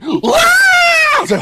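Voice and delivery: high drawn-out imitated shriek, loud; mock banshee bellow. Imitating the neighbor's husband bellowing from the garage, long loud shriek. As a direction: let out a long banshee wail. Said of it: "sounded like a fucking banshee".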